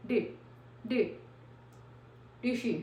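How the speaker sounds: "डिड" with the final d held, not released; stopped d. Shown as a demonstration. Teacher shows students the unreleased d. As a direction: stop the d, don't release it. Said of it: "डी जो है वो silent नहीं होगा लेकिन stop हो जाएगा".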